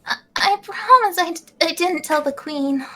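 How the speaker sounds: high nervous stutter; nervous stammer. Acted. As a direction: stammer it out guiltily. Alphys voiced, nervous.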